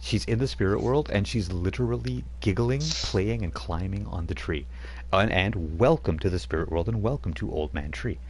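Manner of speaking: ordinary delivery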